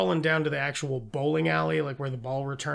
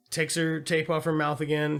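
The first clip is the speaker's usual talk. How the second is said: ordinary talk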